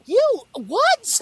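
high, incredulous squeak; can't believe what you just heard; stunned disbelief. Retold or imitated. Retelling her own shock at the reveal, incredulous. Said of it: "I was like".

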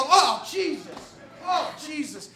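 slow, drawn-out exasperated oath, fading away. Retold or imitated. Exasperated at being put off again.

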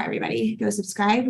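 normal talking voice

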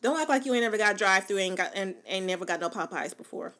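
ordinary talk, nothing marked

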